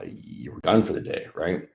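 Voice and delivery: ordinary talk, nothing marked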